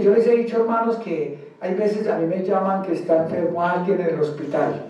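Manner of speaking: ordinary talk, nothing marked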